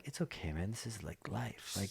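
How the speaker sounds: very soft, hushed reassurance; calm, spaced-out. Retold or imitated. Tripping hiker reassures himself after freaking out, soft and calm. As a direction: soothe yourself in a mellow mushroom haze. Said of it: "weird, like, calm mushroom phase".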